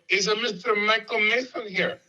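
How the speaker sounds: high, put-on Chicago accent question. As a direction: call out for someone in a mock Chicago accent. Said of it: "I'm doing my best Chicago accent".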